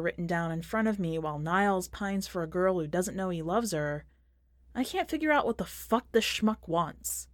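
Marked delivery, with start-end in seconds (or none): none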